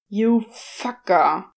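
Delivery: slow, seething hiss; slow, venomous demon hiss. A narrator's reading. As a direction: hiss the insult through a clenched jaw. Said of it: "Crowley hissed".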